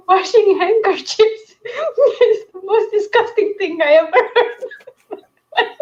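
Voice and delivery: high, slow, squeaking through giggles; real helpless laughter through the words; laughing. Real, not acted. Host to co-host about washing handkerchiefs, laughing through it.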